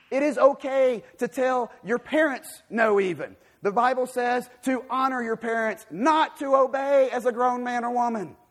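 high, loud emphatic sermon delivery; full-force preaching. Real, not acted. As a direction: drive every phrase home to the congregation.

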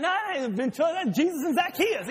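high, quick correction with a laugh; a hint of an onlooker setting them straight, laughing; lightly voiced onlooker. Bystander correcting the crowd, high and laughing. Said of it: "And they're like".

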